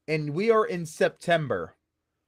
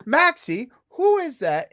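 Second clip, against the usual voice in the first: mom; 9 semitones higher, 3 dB louder, slower than usual. Retold or imitated. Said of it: "And she's like".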